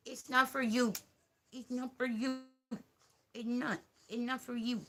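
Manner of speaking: light, insistent reprimand; pet-directed scolding. Real, not acted. Gently scolding the puppy.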